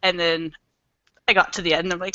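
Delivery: ordinary talk, nothing marked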